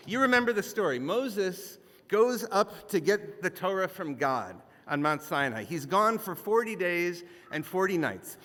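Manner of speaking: ordinary talk, nothing marked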